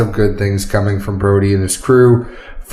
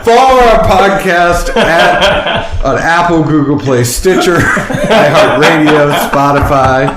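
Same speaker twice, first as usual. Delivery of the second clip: laughing; 6 semitones higher, 4 dB louder than usual. Real, not acted. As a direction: try to read while cracking up. Reads outro while laughing.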